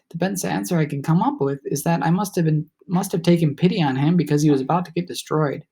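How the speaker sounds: usual speaking voice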